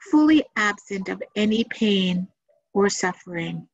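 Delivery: measured meditation guide, long pauses between phrases; unhurried guided-meditation delivery with room to breathe. Acted. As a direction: lead listeners gently through it, phrase by phrase.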